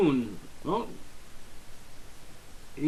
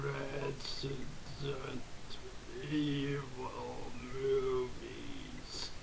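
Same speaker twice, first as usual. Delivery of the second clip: losing voice; 5 dB quieter, much slower than usual. Real, not acted. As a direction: voice fading away into near silence. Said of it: "Kinda lost my voice there".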